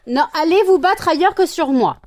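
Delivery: loud exasperated protest. Real, not acted. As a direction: scold them to fight elsewhere. Pets fighting on her.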